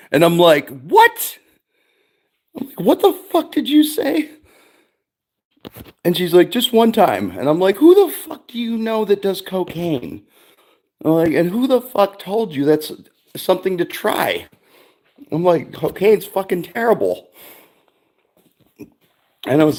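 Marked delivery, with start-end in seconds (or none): 0.7-1.4 mock-shocked, much higher "What?". Dad hears daughter wants cocaine. 2.8-4.3 retold disbelief, pitched far higher; incredulous outburst. Dad retells reaction to daughter. 6.5-7.3 lightly voiced pleading daughter, higher; a hint of the teen asking again; imitating daughter. Daughter's plea voiced by dad. 7.8-17.3 mock-heated lecture, pitched higher; retold scolding. Dad retells scolding daughter.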